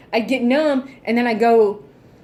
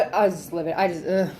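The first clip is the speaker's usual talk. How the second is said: usual speaking voice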